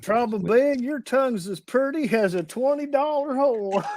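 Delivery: hammed-up backwoods Southern twang; Clampett hillbilly. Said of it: "sounded like one of the Clampetts".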